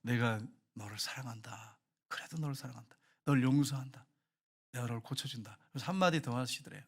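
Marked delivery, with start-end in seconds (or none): 0.0-5.6 voice of God. Retold or imitated. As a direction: speak as a loving God, gently. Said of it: "마음에 음성이 들리는데". (낮고 부드럽게 천천히 말하는 주님의 음성)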